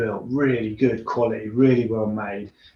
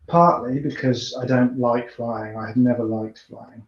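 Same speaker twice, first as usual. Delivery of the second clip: ordinary delivery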